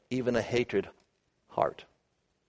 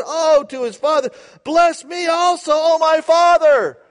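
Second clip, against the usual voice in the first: high, loud anguished wail; bitter cry; 14 semitones higher, 7 dB louder than usual. Acted. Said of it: "cried with an exceedingly great cry and bitter cry".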